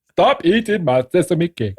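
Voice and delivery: high, drawn-out character voice; put-on voice, stretching out the command; mimicked movie line. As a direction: imitate an indignant order, slow and high. Quoting the film character's angry order.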